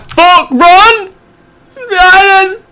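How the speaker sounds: high, loud panicked scream to run; panicked yell. Real, not acted. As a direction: yell in real fright. Chased in horror game.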